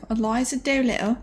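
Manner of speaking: bad American Cockney accent, high and slow; hammed-up stage Cockney, slow; mock Cockney. Streamer mocking a school-play Cockney accent. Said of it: "bullshit American version of Cockney".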